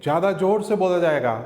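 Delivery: ordinary talk, nothing marked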